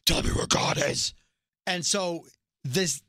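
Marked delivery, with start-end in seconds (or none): 0.0-1.1 imitated otherworldly command, all rasp and no tone; voiceless, forceful mock command; commanding preacher voice. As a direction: put on the preacher's unearthly voice. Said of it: "So preacher uses the voice".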